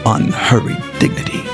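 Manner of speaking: plain reading voice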